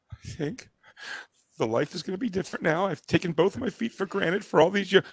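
hammed-up fragile self-pity; mock tragic wife. Husband imitates wife grieving her foot, soft mock tragedy. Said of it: "barely exaggerating when I say it was like".

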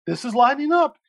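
lightly mocked eagerness, pitch lifted; Rachel Maddow impression. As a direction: mimic a host's hopeful excitement. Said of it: "she's like".

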